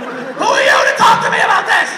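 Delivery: shouting retort. Retold or imitated. Comic shouts at the heckler who said time travel is impossible. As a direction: blow up at the know-it-all. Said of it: "I go".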